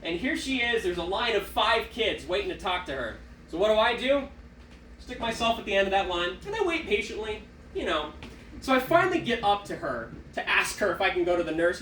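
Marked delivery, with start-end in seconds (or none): none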